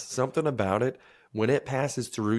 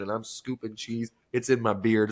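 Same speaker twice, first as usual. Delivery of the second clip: ordinary talk, nothing marked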